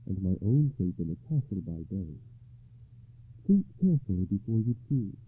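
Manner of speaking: lady's voice. Acted. Ragnall sets the choice.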